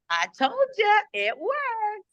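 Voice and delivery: high, singsong crowing; lilting, triumphant tease; playful told-you-so. Retold or imitated. Retells crowing to a helped person.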